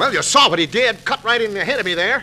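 loud indignant protest. Acted. Husband defending his outburst to his wife. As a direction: still heated, defending himself. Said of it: "excuse for raising your voice".

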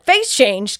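loud, mock-doubting repeat of "Face changed"; incredulous echo. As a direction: throw "Face changed" back loudly as if not believing it. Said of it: "Andy's like".

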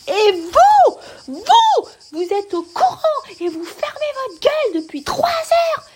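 very high nasal screeching rant; hurlement nasillard aigu. Retold or imitated. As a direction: re-enact a furious shrieked telling-off. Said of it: "j'avais une voix nasillarde, aiguë, je lui hurlais". (engueulade rejouée, suraiguë et nasillarde)

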